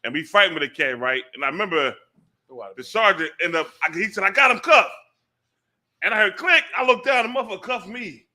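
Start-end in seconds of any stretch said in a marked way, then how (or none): 4.2-4.9 loud, high-pitched announcement of the catch; retold triumphant call. Sergeant to the officers in a cell struggle, calling out in triumph. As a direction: call it out over a scuffle, pitched up.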